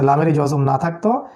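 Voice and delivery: ordinary talk, nothing marked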